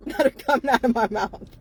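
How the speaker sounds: ordinary talk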